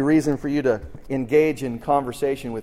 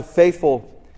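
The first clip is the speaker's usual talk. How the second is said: "faithful" drawn out slowly and louder; stress on faithful; 5 semitones higher, 4 dB louder than usual. Real, not acted. Host praising Bear's column, leaning slowly and loudly on "faithful". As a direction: lean hard on "faithful", slow it down. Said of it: "and I emphasize the word faithful".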